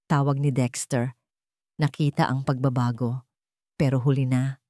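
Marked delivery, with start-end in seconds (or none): none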